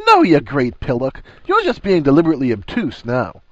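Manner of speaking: playful exasperation. A narrator's reading. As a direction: scold a mate with mock impatience. Ron playfully rebukes Harry.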